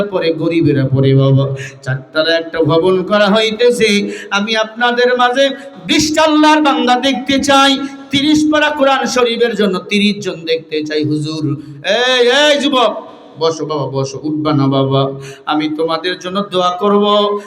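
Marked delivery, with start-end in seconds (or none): 4.3-11.0 high, insistent preaching call; fundraising appeal. Real, not acted. Preacher wanting twenty servants of Allah to give, insistent high appeal. 11.9-13.1 higher, slow drawn-out hail; calling out. Real, not acted. Preacher stopping a youth from leaving, drawn-out call.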